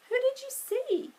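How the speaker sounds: high, quick question; curious Annie. Acted. Sister asking what he saw, curious.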